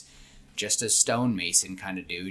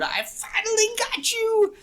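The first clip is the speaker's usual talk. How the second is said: very high, drawn-out victory whoop; loud, squealed playful gloat; triumphant cry; 6 dB louder than usual. Acted. Man punches friend's shoulder, finally right, triumphant cry.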